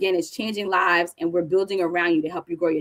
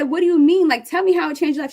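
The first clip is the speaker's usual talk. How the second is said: much higher, excited questioning; imitating her own excited reaction; eager disbelief; 8 semitones higher, 4 dB louder than usual. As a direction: press her to tell you, thrilled. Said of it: "And I said".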